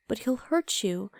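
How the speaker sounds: ordinary talk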